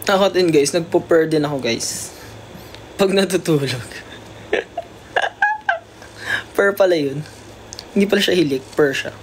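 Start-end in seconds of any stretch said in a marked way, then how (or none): 5.1-5.8 laugh. Real, not acted. Laughs at herself.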